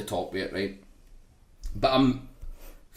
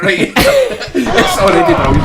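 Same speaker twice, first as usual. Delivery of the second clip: laughing hard, slowing down; can't stop laughing; 10 semitones higher, 11 dB louder than usual. Real, not acted. Watching the fart clip with friends, helpless laughter.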